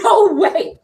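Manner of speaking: high, loud laughing no way; laughing disbelief. Retold or imitated. Reacting to the cash handed over, laughing disbelief. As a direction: squeal through laughter.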